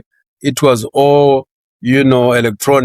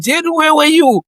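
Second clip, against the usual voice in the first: much higher, puzzled colleague voice; colleagues asking. Retold or imitated. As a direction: voice the coworkers, higher and quizzical. Colleagues asking about missed meeting.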